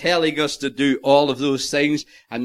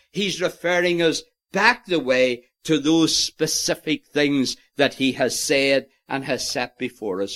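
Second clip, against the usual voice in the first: usual speaking voice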